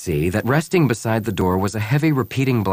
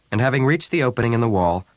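plain reading voice

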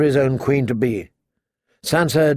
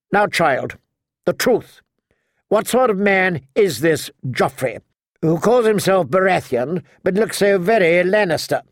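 higher, insistent aged noblewoman; probing old lady; 6 semitones higher than usual. A narrator's reading. Queen of Thorns asking about the king, probing. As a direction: press for an answer as a shrewd grandmother.

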